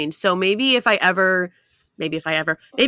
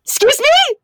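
high incredulous squeak; squeaky shocked excuse me; 4 dB louder, slower than usual. Retold or imitated. Retelling his Snapchat reply, a squeaky shocked excuse me. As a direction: voice jumps up in disbelief. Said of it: "I'm like".

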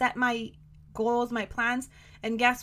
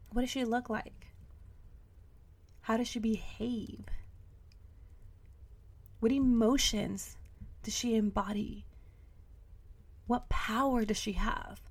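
guided visualization; 3 semitones lower, 10 dB quieter than usual. Acted. Coaching her listeners through a visualization, hushed. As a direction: lead the listener softly into picturing her.

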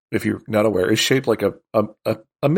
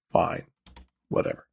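softer, grudging fine, whatever; mock sulky dismissal; 5 semitones lower, 6 dB quieter than usual. Michigan grudgingly accepts the deal, in a soft, sulky voice. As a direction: shrug it off like a sulky state. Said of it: "They're like".

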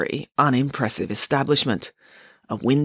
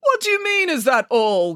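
high outburst dropping at the end; indignant cry; 10 semitones higher than usual. A narrator's reading. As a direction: cry out in disbelief, starting high and falling. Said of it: "cried Mister Green".

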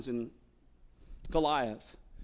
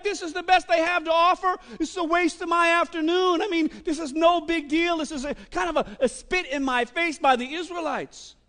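louder, higher indignant rant; mad giant; 10 semitones higher, 6 dB louder than usual. Acted. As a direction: scoff like an insulted champion. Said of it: "He's mad".

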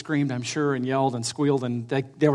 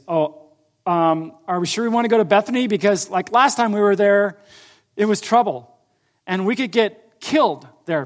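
higher, louder mock worry; retold worried hesitation; 5 semitones higher, 5 dB louder than usual. Disciples balking at going back to Bethany, retold worry. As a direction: voice the disciples' hesitant protest, pitched up.

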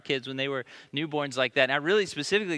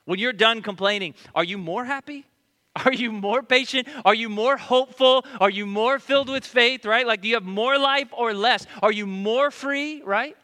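higher, louder rapid-fire questions; preaching at full force; 8 semitones higher, 7 dB louder than usual. Acted. Pastor challenging the congregation, full-force preaching. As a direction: hammer the crowd with questions.

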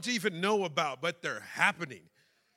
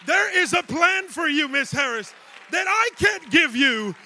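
louder, higher preaching build to a shout; full-force preaching; 9 semitones higher, 9 dB louder than usual. Acted. Pastor calling out Miss Harris from the pulpit, full-force preaching. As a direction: preach it straight at her, rising.

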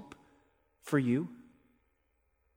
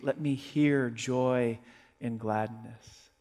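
slow scripture reading; 4 semitones lower, 4 dB quieter, slower than usual. Acted.

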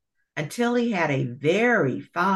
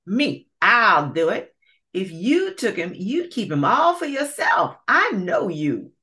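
high, pushy gator accusing; quarrelling alligator; 6 semitones higher, 4 dB louder than usual. Acted. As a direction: squabble over the prize. Alligator claims rabbit.

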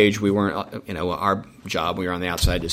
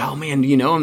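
mildly excited quote, pitched higher; excited wife; 6 semitones higher, 3 dB louder, faster than usual. Retold or imitated. Wife to the promoter guys about the band, mildly excited. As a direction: retell an eager question with a lift. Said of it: "so my wife's all excited".

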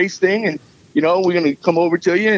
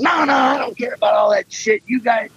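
higher, emphatic retold shout; Rogue's pushy higher-pitched insistence; insistent; 5 semitones higher than usual. Rogue insisting, waving him off.

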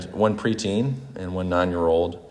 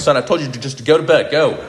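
half-laughing stern order, higher and louder; mock stern dad; 6 semitones higher, 5 dB louder than usual. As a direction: bark the bedtime order with a laugh. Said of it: "I was like".

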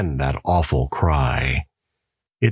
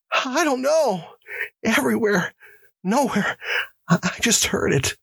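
terrified prisoner. Acted. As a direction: answer shaking with fear.

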